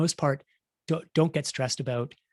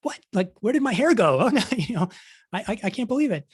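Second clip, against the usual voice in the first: high, loud mock dismay, laughing; shocked at own video; 8 semitones higher, 5 dB louder than usual. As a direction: act horrified at your own hair on screen. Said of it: "You're like".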